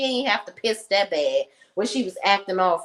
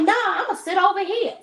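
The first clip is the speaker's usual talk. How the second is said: mocking the boyfriend; 10 semitones higher than usual. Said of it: "He's like".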